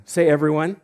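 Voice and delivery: normal talking voice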